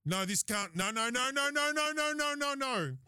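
rapid-fire run of noes climbing high; mock panicked protest. Driver protesting to his touchy wife, mock panic. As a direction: babble it out, pitch soaring then dropping.

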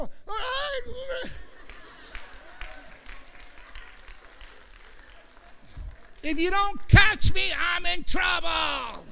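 high, straining shouted cry. Acted. Preacher crying out his leap of faith to God. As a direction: cry it out like leaping blind.

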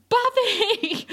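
high, drawn-out cry of the name; retold shout. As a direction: call it out high, imitating a frantic shout. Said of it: "um, shouts".

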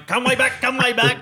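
mock farmer yelling at his Kelpie; a hint of a farmer calling the dog back. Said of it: "You got some farmer going".

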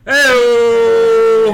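loud, high, drawn-out cheesy holler; punchline cry. Acted. Comic capping his own dirty joke, cheesy holler. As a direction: belt it out like a hack comic.

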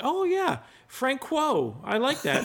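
Francois said as Frank-wo, high and mock-enthused; hammed-up fake delight, mangling Francois; deliberate mispronunciation. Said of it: "mispronounce it intentionally".

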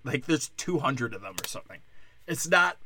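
usual speaking voice

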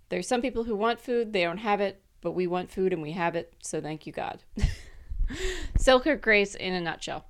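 normal talking voice

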